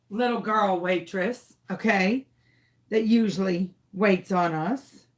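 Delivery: usual speaking voice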